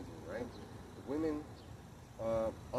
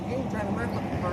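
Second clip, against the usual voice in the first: mock wife; 10 semitones higher, 7 dB louder, faster than usual. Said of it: "and then she".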